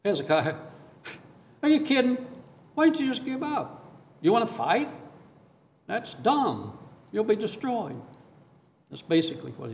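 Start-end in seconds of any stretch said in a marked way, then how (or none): 0.0-8.0 high, scornful taunt at Hezekiah; enemy king jeering at the little king of Judah, mocking; mocking Assyrian king. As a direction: sneer it like a bully king. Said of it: "So he's saying".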